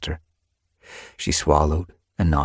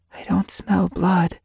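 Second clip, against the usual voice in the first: whisper; 13 semitones higher, slower than usual. Acted. Hiding near the two-legs.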